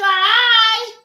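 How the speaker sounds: very high, loud mock agreement; high mock woman. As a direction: squeal her happy reply. Voicing Wineglass.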